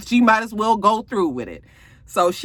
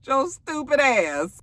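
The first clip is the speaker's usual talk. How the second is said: slow, drawn-out laughing jab; laughing insult; 4 semitones higher, slower than usual. Real, not acted. Recapper mocks a TV character, laughing and drawn out. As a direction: laugh through a teasing put-down.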